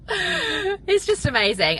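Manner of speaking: high, slow, choked-up and teary; close to tears. Real, not acted. Thanking her podcast listeners, voice breaking with emotion. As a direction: let the emotion crack through. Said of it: "before I start literally crying".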